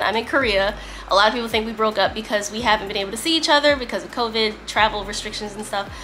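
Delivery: ordinary talk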